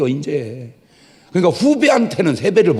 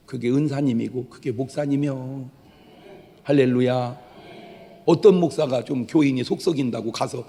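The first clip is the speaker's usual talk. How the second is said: ordinary delivery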